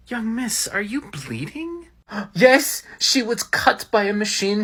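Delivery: ordinary delivery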